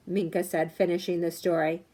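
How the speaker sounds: usual speaking voice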